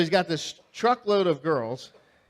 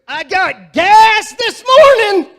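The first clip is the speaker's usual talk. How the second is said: much higher, much louder, slow triumphant shout; proud shout; 18 dB louder, much slower than usual. Retold or imitated. Dad leans out to the principals, shouting proudly, slow and loud. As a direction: bellow it out the window, proud of himself.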